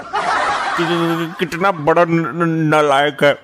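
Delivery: stuttering on opening sounds; nervous stammer. Acted. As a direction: get stuck on syllables while protesting. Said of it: "तुम्हारी आवाज हकलाने लगती है". (घबराकर हकलाना)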